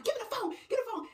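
high, loud, fast demand; frantic demand. Acted. Friend grabbing for her ringing phone, frantic.

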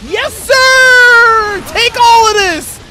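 very high, loud hype screams; stretched-out victory yells; triumphant shouting. Real, not acted. Player attacking the opponent, triumphant shouting.

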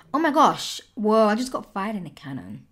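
ordinary talk

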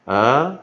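"A" as an isolated schwa, loud with rising tune; loud, questioning schwa for "A"; weak vowel demo. Shown as a demonstration. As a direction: model the weak "A" as a lone "uh". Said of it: "所以怎样".

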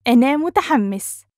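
fast, fluent model sentence; natural speed. Shown as a demonstration. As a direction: run it together with a lift. Teacher repeats phrase at speed.

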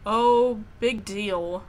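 ordinary delivery

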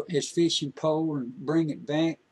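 ordinary delivery, nothing marked

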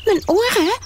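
high, shocked question; high alarmed goose; startled. Acted. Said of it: "vroeg Hansje geschrokken".

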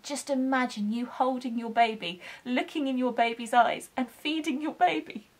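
normal talking voice

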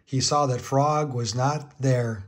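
plain reading voice

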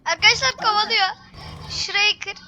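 loud, tense and urgent; panicked under pursuit; being chased. Real, not acted. Chased by robot.

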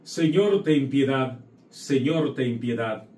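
ordinary talk, nothing marked

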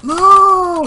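loud, high cry of frustration; dismayed cry. Real, not acted. Cries out as runner goes wrong.

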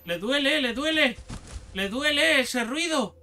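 high, loud gleeful cries; excited. Real, not acted. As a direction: shout it out in delight.

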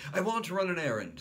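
put-on high fast childish demand; high child's request; eager little boy. A narrator's reading. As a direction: announce it eagerly.

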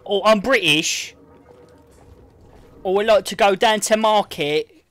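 mock posh British accent, loud and high, as Americans do it; fake British accent. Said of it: "they always do the same accent".

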